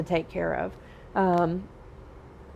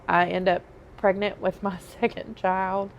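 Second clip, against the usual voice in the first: usual speaking voice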